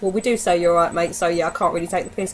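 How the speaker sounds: ordinary delivery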